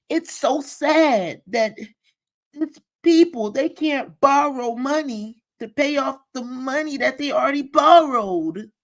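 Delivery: high, fake-sympathetic mimicry of her; mock pitying voice. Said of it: "That's how she sounded".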